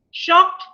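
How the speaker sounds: "Shocked" pitched high and punched; stress on shocked. Shown as a demonstration. Teacher pointing out the key word to students, stressing "Shocked". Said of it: "you see".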